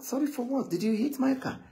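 brushing off the apology with a high questioning tone; mock surprised question. Telling the sorry boy there's nothing to apologise for, mildly surprised. Reassuring boy who hit the car.

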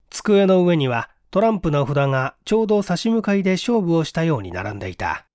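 ordinary delivery

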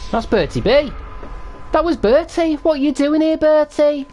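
high excited yelling. Real, not acted. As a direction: shout his name, thrilled. Excited yelling at player Bertie.

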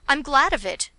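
a loud, lifted exclamation of gladness; father's glad cry. A narrator's reading. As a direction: call it out with eager relief. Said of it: "cried my father".

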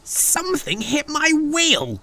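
very high, pained groan; high-pitched truck moaning in pain. A narrator's reading. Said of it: "groaned the truck".

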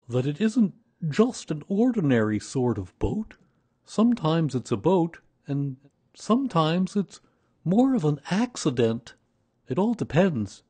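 high, loud bear voice; Pooh, earnest explainer. A narrator's reading. Pooh explains his boat to Christopher Robin, earnest high bear voice.

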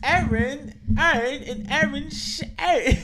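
very high, loud nonsense mimicry; mock garbled speech. Joining in to mock how they sound, high loud caricature. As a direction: squawk the name repeatedly, high and loud. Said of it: "Is that how the fuck we sound?".

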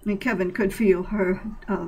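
usual speaking voice

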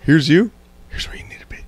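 whispered, unvoiced instruction; hushed aside. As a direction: imitate whispering to a friend in a theater. Retelling a quiet word to friend.

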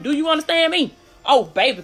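ordinary talk, nothing marked

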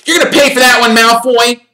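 high, loud threatening yell; boy yelling a threat; furious yell. A narrator's reading. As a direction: scream the threat at Malfoy.

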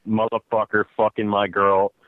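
ordinary delivery

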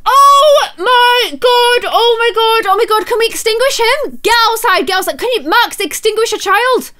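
frantic high pleading; panicked. Real, not acted. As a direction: panic as the fire spreads. Family in the fire.

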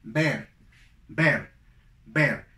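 "ver" as English bear, with eh; punched, separate "ver" like bear; identical pronunciation. Shown as a demonstration. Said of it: "la pronunciación es exactamente igual a la segunda". ("ver" con E, igual que bear)

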